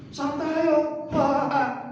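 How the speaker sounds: mock cheery comforter. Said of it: "menghibur dengan ketawa".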